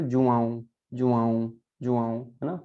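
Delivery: जुआऊं with a nasal आऊं at the end. Shown as a demonstration. Teacher showing the Portuguese name João, nasal ending. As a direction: let the end of जुआऊं ring through the nose. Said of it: "तो उसको हम क्या पढ़ेंगे".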